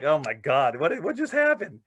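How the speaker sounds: stunned disbelief. Retold or imitated. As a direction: gasp it out, still reeling. Stunned after the take.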